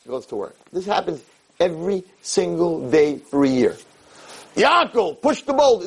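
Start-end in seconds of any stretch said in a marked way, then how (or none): 4.6-5.8 high, ringing call to a man. Acted. God summoning Yaakov to his daily task, calling out. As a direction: call out the order from afar.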